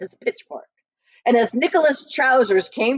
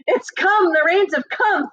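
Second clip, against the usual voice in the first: excited; 9 semitones higher than usual. A narrator's reading. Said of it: "thought Oswald".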